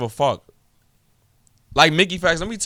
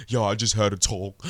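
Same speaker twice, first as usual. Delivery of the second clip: mocking stutter; 5 semitones lower, 3 dB quieter than usual. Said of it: "was like".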